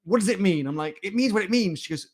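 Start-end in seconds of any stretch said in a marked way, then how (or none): 0.0-0.6 high, louder mock interrogation; ruthless questioning. Ruthless colleague questioning [name]'s documentation, mock demanding. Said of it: "She's really ruthless". 1.0-1.8 high-pitched defensive comeback; mock defensive retort. [name] answering criticism of his documentation, mock defensive. Retold defensive reply to colleague.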